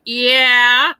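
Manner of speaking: smug drawn-out yeah; a mildly cocky retold yeah. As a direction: answer with a cocky shrug. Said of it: "real cocky and went".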